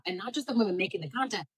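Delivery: normal talking voice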